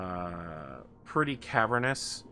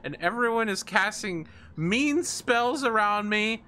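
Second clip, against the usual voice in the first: high, pleading near-tears whine; about to cry; 11 semitones higher, 3 dB louder than usual. Acted. Succubus begging the party for king's blood, about to cry. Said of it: "she looks like she's about to cry".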